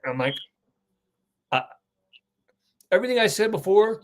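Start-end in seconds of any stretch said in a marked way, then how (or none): none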